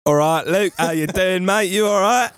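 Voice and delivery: a cheeky impression of a Scouse lad saying hello; Scouse accent. Mate greets Luke in a hammed-up Scouse accent. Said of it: "your Scouse accent".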